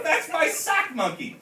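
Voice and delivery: high, slow yell. A narrator's reading. Scipio spotting his sock monkey, slow high yell. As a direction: drag out the outrage. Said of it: "yelled Scipio".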